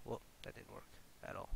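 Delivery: whispered frustration; muttered whisper. Real, not acted. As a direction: breathe it under your breath. Cut fails again.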